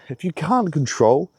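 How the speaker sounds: sudden pitch crack while ranting. Real, not acted. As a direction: voice cracks up high mid-phrase. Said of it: "voice crack".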